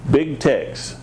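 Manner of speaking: ordinary delivery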